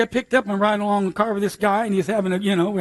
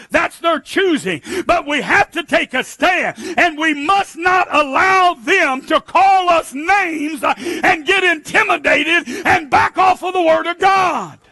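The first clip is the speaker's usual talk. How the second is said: loud, high, slow preaching; thundering sermon peak; preaching at full force; 7 semitones higher, 4 dB louder than usual. Real, not acted. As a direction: build to a fiery pulpit climax. Preaching climax.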